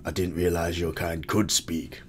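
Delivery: low, soft put-on character accent; Jon Snow accent. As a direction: drop low and quiet into an imitation accent. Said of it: "That's my Jon Snow accent".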